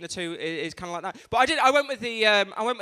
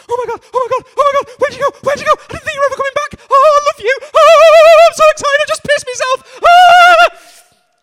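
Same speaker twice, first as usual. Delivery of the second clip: very high, very loud frantic squeal; needy, overexcited dog; 19 dB louder than usual. Acted. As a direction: yelp it like a dog whose owner is back. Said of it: "your dog's sort of sat there going".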